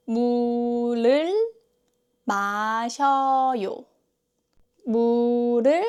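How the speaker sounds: 물을 drawn out as mu-reul; slow ㄹ demonstration. Shown as a demonstration. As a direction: stretch the syllables of 물을 마셔요 for learners, tapping the linked ㄹ. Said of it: "third place".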